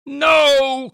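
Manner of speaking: very high, loud cry of horror; anguished cry. Acted. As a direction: scream it as she falls. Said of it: "cried Simeon".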